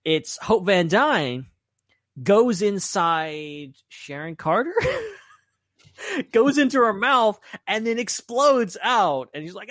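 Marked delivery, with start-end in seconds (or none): none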